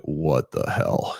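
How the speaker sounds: low, slow, drawn-out reaction; retold disbelief. Coach seeing the runner show up overweight, slow mock disbelief. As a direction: imitate a coach taken aback, dragging the words.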